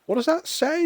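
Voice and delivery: very high prim voice of the mum; prim mother. Retold or imitated. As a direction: ask like a shocked proper lady. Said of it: "her mum looked at it and went".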